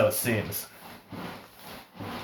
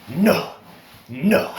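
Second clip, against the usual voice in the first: loud, raised, insistent refusal; imitated annoyance; 6 semitones higher, 6 dB louder than usual. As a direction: act out an exasperated man cutting in. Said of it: "He's, like, pissed".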